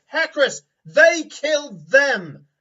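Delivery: high, loud, slow accusing yell; furious shout. Acted. Warrior accusing the party over the dead men, furious shout. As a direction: roar it, pointing at the bodies. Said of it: "And she goes".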